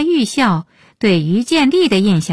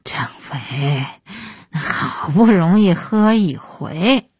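grinning, drawn-out coaxing plea; cheekily cajole a superior for leniency; playful wheedling; slower than usual. A narrator's reading. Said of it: "鹏飞嬉皮笑脸".

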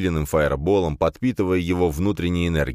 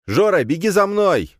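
loud high shout calling a friend; 13 semitones higher, 5 dB louder than usual. A narrator's reading. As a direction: yell it out urgently. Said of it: "закричал я".

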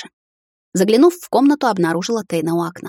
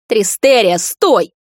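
louder shout after her; 3 semitones higher, 6 dB louder, much slower than usual. A narrator's reading. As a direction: call her back sharply. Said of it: "вновь закричал за спиной муж".

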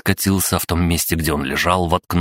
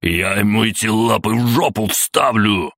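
higher, strained threat; furious roar; 5 semitones higher than usual. A narrator's reading. Captain threatening the spider robot, roaring angrily. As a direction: roar the threat with raised pitch. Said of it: "проревел капитан Каннон".

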